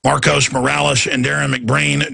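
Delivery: usual speaking voice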